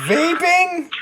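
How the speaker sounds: high, sharp disbelief; incredulous. Real, not acted. Incredulous reaction to vape claim.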